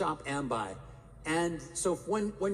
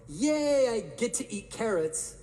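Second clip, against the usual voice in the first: forced cheer, much higher and louder; fake cheer; 7 semitones higher, 4 dB louder, slower than usual. Speaker mocking forced positive self-talk, fake cheer. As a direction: oversell the joy of carrots. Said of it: "I go".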